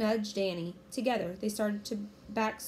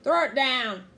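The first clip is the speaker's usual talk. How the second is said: loud, raised pirate command; pirate cry; 5 semitones higher, 9 dB louder than usual. A narrator's reading. Captain Bones ordering the kids, loud pirate cry. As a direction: bellow it as an order. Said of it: "cried Captain Bones".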